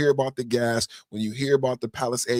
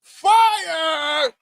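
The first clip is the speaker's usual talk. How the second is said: high, loud whooping cheer with a laugh; real hyped cheer; excited whoop; 8 dB louder, much slower than usual. Real, not acted. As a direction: whoop like the best moment ever. Reacting to the ad ending.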